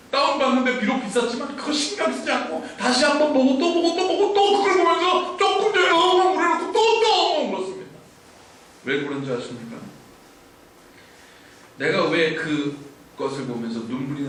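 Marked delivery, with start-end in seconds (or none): 0.1-7.9 rising higher and louder as he piles it on; excited building outburst. Real, not acted. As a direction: build to a loud high peak. Excited retelling of rewatching.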